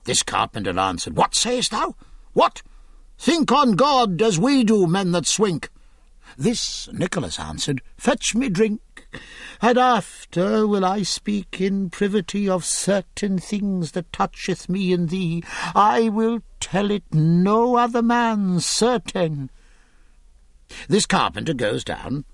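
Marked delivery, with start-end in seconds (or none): 1.2-5.7 higher, sharp What? then pleading; a simple man caught off guard; a touch of alarm; startled carpenter. Acted. 8.0-19.5 drawing him into a secret; lightly voiced sly clerk; secretive scholar. Nicholas confides secret.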